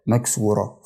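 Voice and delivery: normal talking voice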